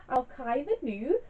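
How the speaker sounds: jokey, badly faked Scottish accent. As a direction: put on a terrible Scottish accent for a laugh. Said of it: "that was a really bad Scottish accent".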